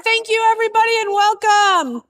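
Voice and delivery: projecting to a crowd; loud welcome. Real, not acted. Addressing the crowd without a microphone.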